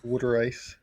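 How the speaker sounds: "Water" in a Philadelphia accent, like "wooder"; Philly accent. Shown as a demonstration. Co-host showing the local way to say water ice, Philadelphia accent. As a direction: say "Water ice" Philly-style, first vowel as in "wood". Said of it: "Got the accent there".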